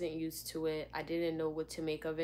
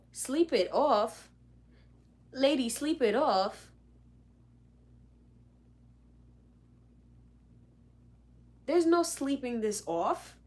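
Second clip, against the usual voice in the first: incredulous; 7 semitones higher, 8 dB louder than usual. Retold or imitated. Outraged disbelief at teacher.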